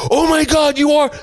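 high, astonished squeal of recognition; starstruck fan. Retold or imitated. Fan realizes he really is the host, high and amazed. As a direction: gasp it out way up high. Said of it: "He's like".

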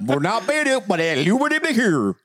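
rising and falling gibberish recitation; gibberish Bible reading. Pretend. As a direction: recite scripture as rubbery nonsense syllables. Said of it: "they should have a Bible version of this".

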